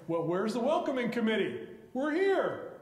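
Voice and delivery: calling out, rising high at the end; a hint of a jaunty captain expecting a welcome; mildly hammed-up eager call.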